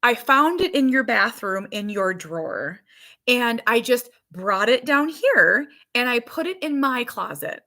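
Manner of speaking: playfully voiced little girl; little daughter's voice. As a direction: mimic a small child's careful explanation. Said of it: "this is how she talks".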